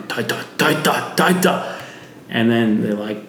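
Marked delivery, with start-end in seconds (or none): 0.0-1.8 breathy, higher calls rising to the end; weird possessed calling. Retold or imitated. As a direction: call Taita as if possessed. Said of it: "I'm just going".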